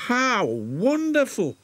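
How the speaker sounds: a hopeful engine asking his friend; thrilled engine. Acted. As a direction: bubble over with hope. Engine thrilled by wishing tree.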